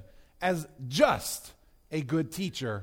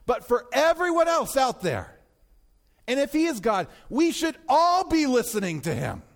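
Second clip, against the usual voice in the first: raised, insistent sermon voice; higher-pitched, forceful pulpit delivery; emphatic preaching; 5 semitones higher, 3 dB louder than usual. Real, not acted. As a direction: drive the argument home to the congregation.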